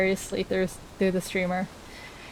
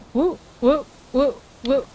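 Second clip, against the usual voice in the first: playful woo sounds; 5 semitones higher than usual. Pretend. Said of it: "go like".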